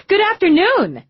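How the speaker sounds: high, bright-loud greeting; enthusiastic. A narrator's reading. Receptionist gushing a greeting at the detective, enthusiastic. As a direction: gush the welcome like a perky receptionist. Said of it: "she said so enthusiastically".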